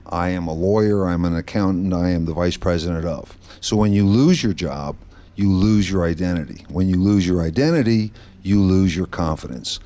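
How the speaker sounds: ordinary talk, nothing marked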